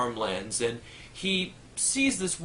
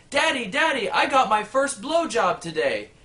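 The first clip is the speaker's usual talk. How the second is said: put-on excited kid; 8 semitones higher, 4 dB louder than usual. Excited son runs home.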